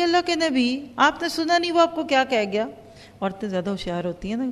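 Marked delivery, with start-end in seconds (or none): 0.0-2.7 indignant wife. Retold or imitated. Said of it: "کہنے لگے".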